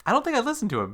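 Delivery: high incredulous. Retold or imitated. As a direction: squeak it out in disbelief. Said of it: "I was like".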